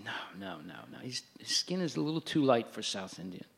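low, very soft imitated aside; hushed retold disagreement. Other lady answering during the speech, hushed retold aside. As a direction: play a discreet objection under a speech.

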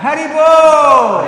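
long, high, loud jubilant shout; joyful cheer. Acted. Devotees celebrating the miracle, shouting joyfully. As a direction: cry it out in triumph.

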